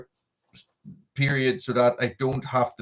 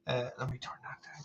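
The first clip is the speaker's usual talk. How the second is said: whisper; 15 dB quieter than usual. Real, not acted. Turning down stream audio.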